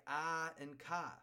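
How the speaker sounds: car said as ka with the R dropped, Boston style; Boston accent. Retold or imitated. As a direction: imitate locals saying ka for car. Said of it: "everybody's like".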